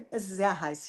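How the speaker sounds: very soft, low, emotionless German; anger held down to almost nothing; restrained German anger. Shown as a demonstration. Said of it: "é o máximo, assim, de raiva". (raiva contida, baixinho e sem emoção)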